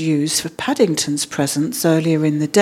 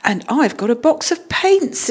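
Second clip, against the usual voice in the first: put-on higher-pitched girlish delight; exclaimed; 7 semitones higher than usual. A narrator's reading. Judy opening her present, exclaiming with delight. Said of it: "exclaimed Judy".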